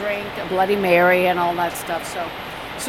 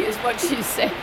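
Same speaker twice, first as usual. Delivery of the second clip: ordinary talk, nothing marked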